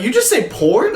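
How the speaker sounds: imitated high-pitched questioning; incredulous. Classmates questioning what he just said, incredulous. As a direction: echo a classmate's disbelief, rising high.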